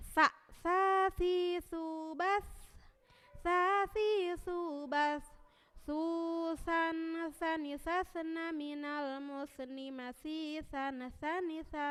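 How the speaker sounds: "sa, si, su, bas" loud, hissing through; tsa recitation. Shown as a demonstration. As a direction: let each sa hiss. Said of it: "mengalir mengucapkan huruf-hurufnya".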